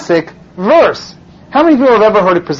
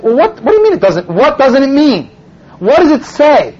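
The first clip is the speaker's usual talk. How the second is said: incredulous challenge; 6 semitones higher than usual. Retold or imitated. Rabbi challenging the missionary's excuse, retold incredulous challenge. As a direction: push back hard on each question.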